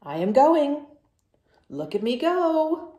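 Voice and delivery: loud, insistent announcement; defiant. Acted. Gerald tells Piggy he's going too, loud and defiant. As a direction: show him you're going too.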